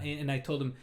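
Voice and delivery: ordinary talk, nothing marked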